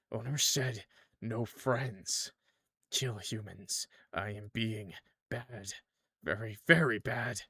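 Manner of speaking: subdued, slow, obedient clown; quiet submissive clown creature. Acted. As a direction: hush it like a cowed pet. Clown repeats owner's rule.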